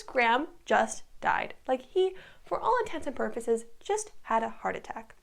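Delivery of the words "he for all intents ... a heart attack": ordinary talk, nothing marked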